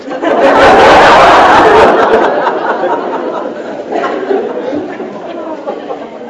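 a long, high, airy laugh. Real, not acted. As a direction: keep laughing at the story. Laughs at own story.

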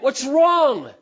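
high, loud caring question; concerned husband. Acted. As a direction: ask your hurting wife. Husband voiced asking his wife.

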